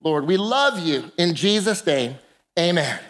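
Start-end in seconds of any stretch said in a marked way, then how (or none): none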